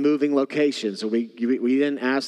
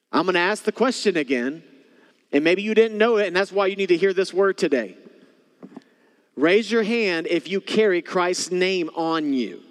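passionate appeal; 7 semitones higher, 3 dB louder than usual. Acted. Altar call intensity.

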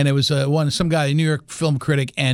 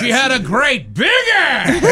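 high, slow, hammed-up movie bellow; Al Pacino; 7 semitones higher, 4 dB louder, slower than usual. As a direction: stretch it out as an over-the-top actor impression. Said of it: "from Heat. When he was in Heat".

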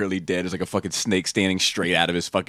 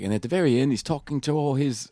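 bad Australian accent; 8 semitones higher than usual. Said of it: "I do a horrible Australian accent".

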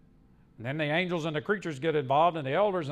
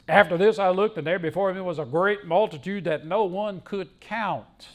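loud, raised pulpit reading; forceful scripture reading, louder and higher; scripture proclamation; 4 semitones higher, 8 dB louder than usual. Acted. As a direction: read the verse out boldly to a big congregation. Preacher reads scripture aloud.

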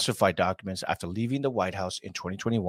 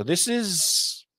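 slow drawn out; 9 semitones higher than usual. Real, not acted. Drawn-out emphasis.

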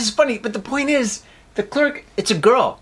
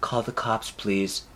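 much softer, low and deflated plea; meek and quiet; 9 semitones lower, 10 dB quieter, slower than usual. Retold or imitated. Robber meekly asking the clerk to call police, soft. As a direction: give up, barely above a murmur.

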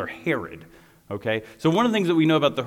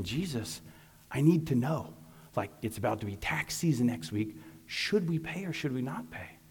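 mock innocent plea; 8 dB quieter than usual. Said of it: "They sounded so innocent".

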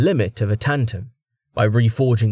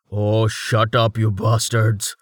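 slow raspy grumble; rasping; slower than usual. Acted. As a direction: rasp it out, annoyed. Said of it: "Thrast rasped".

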